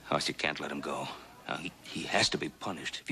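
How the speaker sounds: low urgent whisper. Acted. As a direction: whisper it, keep it down. Hushed talk beside sleeping man.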